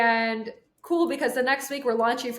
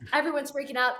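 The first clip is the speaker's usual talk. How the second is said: normal talking voice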